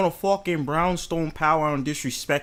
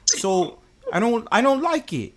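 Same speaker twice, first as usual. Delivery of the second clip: high, soft put-on London voice; London accent; 4 semitones higher, 3 dB quieter than usual. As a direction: complain as a mock Londoner. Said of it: "should I speak in my London voice".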